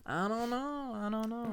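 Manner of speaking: sing-song high. Pretend. Playful high sing-song repeats.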